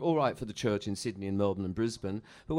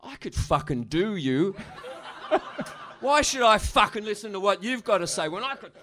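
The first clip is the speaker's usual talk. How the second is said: menacing bully; 8 semitones higher than usual. Retold or imitated. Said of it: "He'd be looking at me like".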